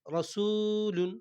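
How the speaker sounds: slow recited রসুলুন with long vowels; chanted রসুলুন; drawn-out recitation. Shown as a demonstration. Quran reading drawn out.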